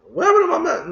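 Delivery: frustrated coder cracking in disbelief; squeaky disbelief. Real, not acted. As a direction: voice jumps up in exasperation. Exasperated outburst.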